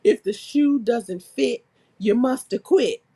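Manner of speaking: slow, emphatic courtroom slogan; drawn-out mock lawyer's closing line; mock courtroom plea. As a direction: declare it slowly as if addressing a jury. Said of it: "I'm gonna be like".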